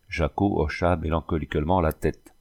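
ordinary delivery

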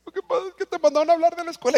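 gimoteo agudo y rápido fingido; llanto fingido. Retold or imitated. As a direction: lloriquea la confesión a toda prisa. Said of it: "No estaba llorando, estaba para que no me fuera tan mal". (niño confiesa gimoteando con llanto fingido)

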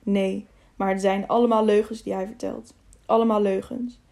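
ordinary delivery, nothing marked

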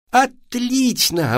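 high, long drawn-out cheer; gleeful boy's exclamation; delighted. A narrator's reading. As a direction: stretch out ОТЛИЧНО with glee. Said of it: "обрадовался Вася".

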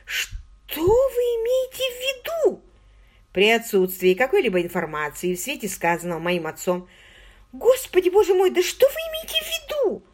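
high, alarmed disbelief; shocked. A narrator's reading. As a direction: gasp it out in shock. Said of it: "Маргот вытаращила глаза".

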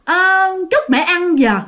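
a lightly voiced ghost turning down food; eerie ghost mother. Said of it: "Thì bả nói".

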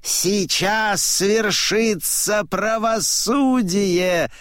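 high, slow proclamation; high-voiced old man declaring; old man proclaiming. A narrator's reading. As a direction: intone it slowly like a solemn verdict. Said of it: "говорит старик".

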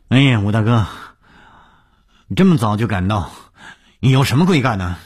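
high, loud effusive greeting; beaming cordiality. A narrator's reading. Host greeting 武刚 at the office, effusively cordial. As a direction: greet him heartily, pitch lifted. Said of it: "满面春风".

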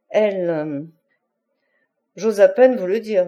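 plain reading voice

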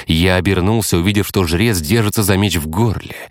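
ordinary delivery, nothing marked

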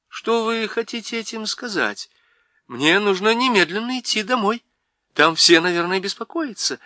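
put-on worried girl pleading to go home; frightened girl. Lucy frightened, insisting to the Faun she must leave. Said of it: "Ей стало страшно".

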